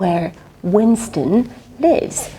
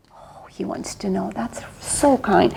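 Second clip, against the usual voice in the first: whispered aside; 6 dB quieter than usual. Acted. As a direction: whisper it with irony. Mocking aside about Winston.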